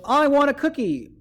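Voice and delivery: really excited about this cookie, shouting; shouted. Shown as a demonstration. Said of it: "a word like shouted".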